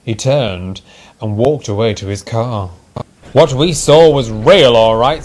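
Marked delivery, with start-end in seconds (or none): 3.3-5.2 loud, emphatic insistence; firm. A narrator's reading. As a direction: insist firmly, raised voice. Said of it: "said the driver firmly".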